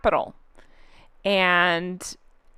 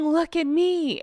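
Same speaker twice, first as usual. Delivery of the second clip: higher mock attention-seeker; Meghan; 7 semitones higher, slower than usual. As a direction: lightly mimic a plea for attention. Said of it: "She's literally playing".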